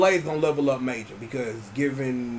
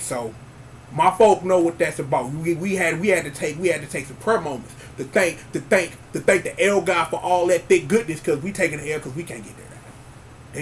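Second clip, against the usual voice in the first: ordinary talk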